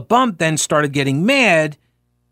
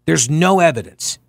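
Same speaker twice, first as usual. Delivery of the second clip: ordinary delivery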